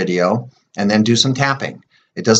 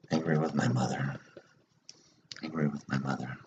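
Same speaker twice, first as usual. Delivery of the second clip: much softer and lower, half under the breath; murmured tapping phrases; 7 semitones lower, 13 dB quieter than usual. Shown as a demonstration. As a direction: murmur each phrase to yourself while tapping. Repeating tapping phrases to herself.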